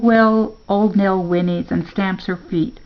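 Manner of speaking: usual speaking voice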